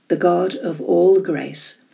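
ordinary delivery

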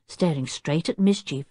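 plain reading voice

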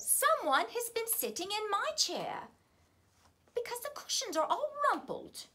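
Mother Bear voice. A narrator's reading. Mother Bear voiced, medium-sized bear.

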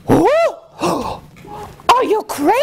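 very high, shrieked lady's voice; gasp, then squeal in outrage; shocked yell. Acted. Lady in waiting appalled by the swineherd's price, high shocked yell. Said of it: "yelled the lady in waiting".

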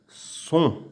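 "Son" nasal, no final n; "Son" 은 비음으로 끝내기. Shown as a demonstration. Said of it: "on dit son".

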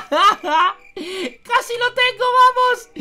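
laughing, high and loud; excited laugh. Acted. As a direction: cheer yourself on laughing. Climbing floor by floor, straining.

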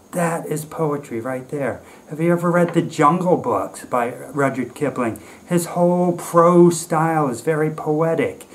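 normal talking voice

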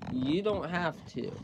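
ordinary delivery

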